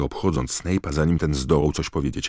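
plain reading voice

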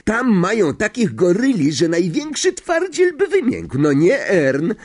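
high, excited, pitch soaring; marvelling conductor. Acted. Stan wonders to the driver about Azkaban, amazed. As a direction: marvel at the escape in character.

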